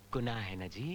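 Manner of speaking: low, soft aside after the lively account; pointed leading question. Acted. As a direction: drop down low and softly ask for agreement.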